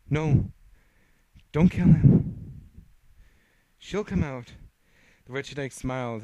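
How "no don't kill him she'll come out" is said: loud, high, slow pleading; desperate plea. Acted. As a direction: beg loudly, slowly. Lucy pleading.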